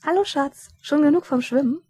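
high, bright greeting to a husband; cheery wife's greeting. A narrator's reading. Wife suddenly notices her husband.

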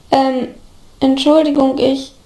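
halting, unsure apology; timid, broken-up question; hesitant murmur. A narrator's reading. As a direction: feel your way into the question with pauses. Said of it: "murmelte Oliver schließlich leise".

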